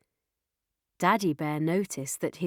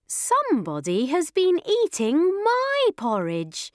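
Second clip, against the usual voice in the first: higher, lilting mother bear; story-time mama bear, raised pitch; middle-sized Mummy Bear voice; 7 semitones higher, 3 dB louder than usual. A narrator's reading. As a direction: pitch it up, swooping with indignation. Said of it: "in her middle-sized voice".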